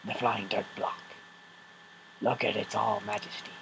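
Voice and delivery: soft, fast hushed narrator; nature documentary. Pretend. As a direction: whisper like a wildlife presenter.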